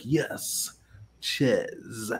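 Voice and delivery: hushed, low mock French accent. Customer passing as French at McDonald's, mock French accent. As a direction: answer softly in a put-on French accent.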